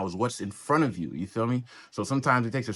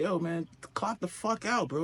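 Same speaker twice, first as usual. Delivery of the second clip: pitched up, telling him to clock out; a higher mocking plea to quit the job; mock high call-out; 8 semitones higher, 3 dB quieter than usual. To an overworking cop, mock high call-out.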